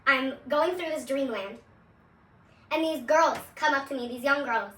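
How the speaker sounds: ordinary talk, nothing marked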